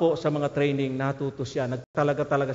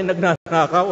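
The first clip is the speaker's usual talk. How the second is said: ordinary talk, nothing marked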